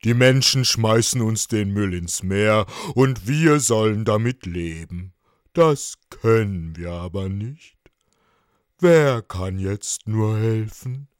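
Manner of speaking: loud, put-on elderly creature voice; weighty octopus voice, troubled; old octopus, at a loss. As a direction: complain like a helpless old sage.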